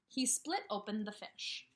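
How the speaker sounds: normal talking voice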